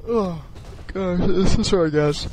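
talking through a yawn; words stretched by a yawn. Real, not acted. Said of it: "Sorry for this yawning".

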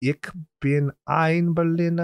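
deliberate, hammed-up German accent. Podcaster showing off the accent to co-host, slow German delivery. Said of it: "that's what that accent is".

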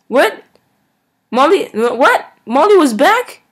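high, loud startled questions; can't believe she's back; shocked disbelief. Real, not acted.

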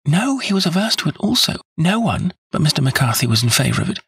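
put-on high, eager plea from a devoted young woman; earnest young woman. A narrator's reading. Miss Turner begging Holmes to clear James, eager and high. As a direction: insist on his innocence, breathless with hope.

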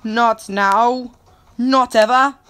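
loud, high, declaring each refusal; playful over-dramatic protest; mock-desperate defiance. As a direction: cry out a hammed-up refusal, rising at the end. Mock-desperate refusal while losing game.

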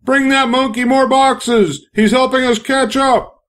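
high, slow excited call in a different voice; yelled. A narrator's reading. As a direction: cheer them on from across the room. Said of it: "Someone noticed and yelled".

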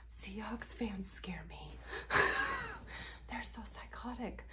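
whispered like a secret; mock secret whisper. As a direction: lean in and whisper the confession. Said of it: "come in close".

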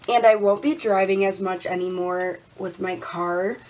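ordinary talk

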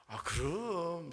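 high, drawn-out Seoul-style assent; Seoul accent groom. Acted. Seoul groom consents to his bride, high lingering Seoul accent. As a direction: agree eagerly, stretching the word in Seoul speech. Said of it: "신랑이 그럽니다".

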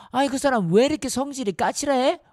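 high, irritated question; exasperated complaint. Acted. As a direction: huff at how prickly he is. Annoyed complaint about grumpy fisherman. (어이없다는 듯 짜증 섞인 질문)

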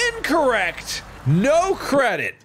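high, drawn-out indignant verdict; mock outrage. Player grading someone's fifty-seven dollar bridge, mock outrage. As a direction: pronounce the failing grade in a high, indignant cry.

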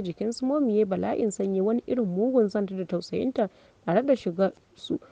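ordinary delivery

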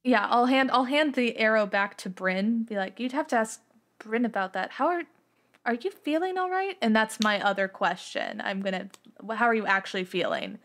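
usual speaking voice

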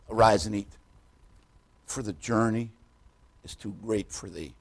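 soft, low, fading delivery of the verse; tender quoting. Acted. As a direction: let the comfort sink in. Tender emphasis on favourite verse.

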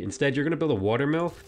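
ordinary talk, nothing marked

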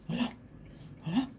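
ordinary delivery

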